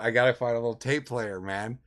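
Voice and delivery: normal talking voice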